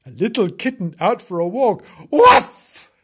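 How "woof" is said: high, very loud woof; dog bark. Acted. Dog surprised by a kitten, barking. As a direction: bark suddenly like an excited dog. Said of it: "he barked".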